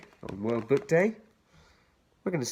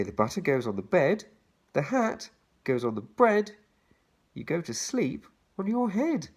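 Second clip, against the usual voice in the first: put-on bouncy children's rhyme read; 5 semitones higher than usual. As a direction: lilt each line to its rhyme. Reads the rhyme aloud.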